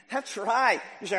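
ordinary talk, nothing marked

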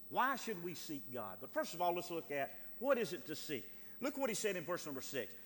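ordinary delivery

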